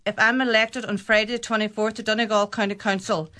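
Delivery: ordinary talk, nothing marked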